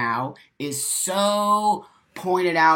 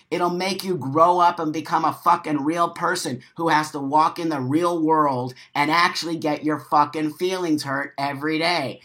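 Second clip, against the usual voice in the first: ordinary delivery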